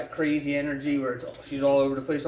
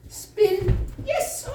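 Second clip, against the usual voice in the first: high dog cue; 4 dB louder than usual. Acted. Cueing and praising the dog.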